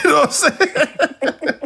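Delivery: slow, laughing it out; words squeezed through laughter. Real, not acted. Telling a friend about a weird call, laughing.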